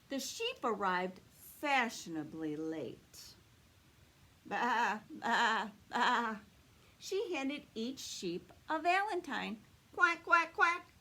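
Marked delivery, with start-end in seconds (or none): 4.5-6.4 lightly voiced sheep baas, a bit louder; bleating sheep. Sheep arriving fashionably late, a light bleat. 10.0-10.9 high, much louder duck quacks; quacking duck. Acted. Little Duck greeting the sheep, loud high quacks.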